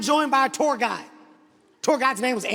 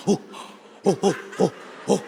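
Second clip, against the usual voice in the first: short imitated ape hoots; gorilla hoots; 5 semitones lower than usual. Comic imitating tour guide who keeps going, gorilla hooting. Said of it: "Antoine keeps going".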